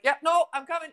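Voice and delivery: high, loud breezy "yep, coming"; imitating date's exit. Retelling how her date bailed, a high, loud breezy call. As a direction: toss it off like you're being called away. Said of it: "He's like".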